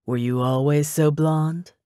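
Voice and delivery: slow, drawn-out teasing question; leering ex. A narrator's reading. Ex eyeing her new blonde hair, leering slowly. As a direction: draw it out suggestively, as her ex.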